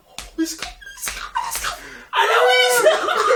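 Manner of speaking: breathy, laughing build to a high cry; giddy rising excitement; excited anticipation. Retold or imitated. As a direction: hype yourself up as it's coming. Retelling rewatch excitement.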